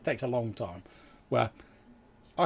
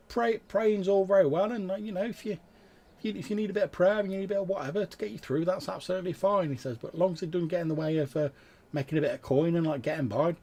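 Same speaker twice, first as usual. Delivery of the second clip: lightly voiced ferryman, higher pitched; a slightly raised, live-and-let-live character voice; easygoing ferryman; 8 semitones higher than usual. Ferryman shrugging off the priests' praying, lightly voiced and easygoing.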